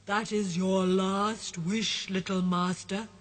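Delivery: slow, low genie voice; a grand spirit, slow and measured. Acted. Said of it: "says the voice".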